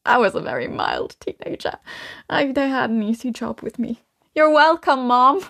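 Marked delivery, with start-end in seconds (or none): 4.3-5.4 higher, teasing call to a parent; mock-proud singsong; playful boast to Mom. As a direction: jokingly claim credit, pitched up. Playfully addresses her own mother.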